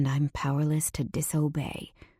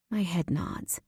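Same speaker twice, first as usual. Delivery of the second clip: plain reading voice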